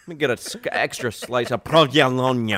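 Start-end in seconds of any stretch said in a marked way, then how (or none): 1.6-2.5 PROVOLONE said as "prov-ya-LON-ya"; mock-foreign provolone. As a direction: slip a J into provolone, with relish. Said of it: "adding a J in there".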